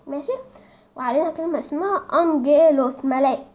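usual speaking voice

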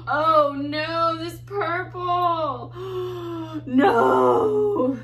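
loud, drawn-out wail of dismay. Real, not acted. Painter upset by the purple, loud dismayed wail. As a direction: gasp and wail, building to a loud no.

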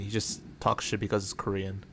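ordinary talk, nothing marked

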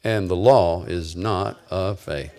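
slow, low line to repeat; deliberate, low-pitched recital; measured recitation. Shown as a demonstration. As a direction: lead the congregation through it slowly. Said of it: "say this with me".

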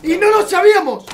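loud, high-pitched proclaiming; amazed exclamation. Real, not acted. Streamer declaring the player Jesucristo, amazed shouting. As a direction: shout it out in disbelief.

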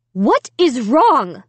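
slow, emphatic mild scream; screaming mother. A narrator's reading. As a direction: demand it in alarm, each word hit. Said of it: "Alicia's mother was screaming".

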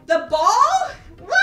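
high loud disbelieving question; can't believe he chose it; surprised. Real, not acted. Reacting as the dog picks the ball, high surprised.

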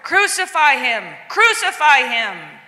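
loud, insistent demand; crowd crying out. Acted. Priests and guards demand death, loud cries. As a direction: shout for his condemnation. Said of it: "they cried out".

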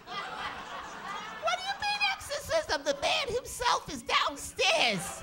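high, slow, shouted disbelief; wife shouting indignantly at her doubting husband. Retold or imitated. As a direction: bellow it through laughter at her husband.

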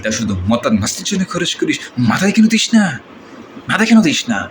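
ordinary delivery

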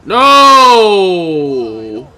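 drawn out loud no in dismay; real dismay at the screen; loud groaning no. Real, not acted. Reacting to a missed play.

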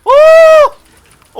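loud high yelp; excited yell. Real, not acted. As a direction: cry out with a thrill.

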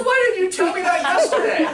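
high, loud laughing complaint; laughing protest. Retold or imitated. Young nurse laughing at the teller's trick. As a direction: laugh it out in disbelief. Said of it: "He goes".

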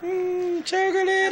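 goodbye squeaked way up high; mock high farewell. Real, not acted. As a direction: pitch up into a silly goodbye.

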